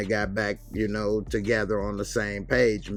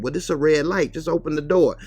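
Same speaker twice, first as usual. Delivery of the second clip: higher and louder demand; arguing; 6 semitones higher, 6 dB louder than usual. Retold or imitated. Women arguing with the bus driver, louder. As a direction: push him to open up.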